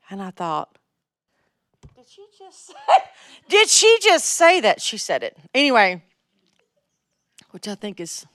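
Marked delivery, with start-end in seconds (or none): 2.0-2.7 tiny, high, barely audible mock-shocked voice; stunned disbelief. Acts out her stunned thought. 3.5-4.8 high, loud mock-shocked question, drawn out; incredulous outcry. Repeats stunned thought out loud.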